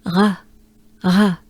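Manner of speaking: Ra with a breathy French r; Ra, breathed r, tongue down. Shown as a demonstration. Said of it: "let's take a good breath".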